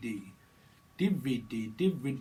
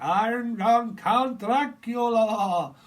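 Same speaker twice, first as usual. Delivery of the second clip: high, loud, slow vampire voice; hammed-up Count Dracula; 9 semitones higher, 10 dB louder, much slower than usual. Medium hams up Dracula, high and booming. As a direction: announce yourself grandly as a vampire count. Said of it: "Drazik or Dracula".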